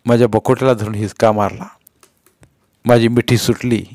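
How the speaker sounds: ordinary delivery, nothing marked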